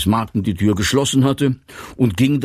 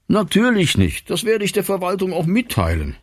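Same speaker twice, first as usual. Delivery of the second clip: much higher, placating voice; 10 semitones higher than usual. A narrator's reading. Bertha soothes Martin about the complaint, high and placating. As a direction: agree readily in a raised, high pitch.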